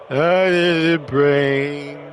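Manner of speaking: slow, moaning zombie voice. Pretend. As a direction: groan it out like a hungry zombie. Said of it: "Zombie moaning".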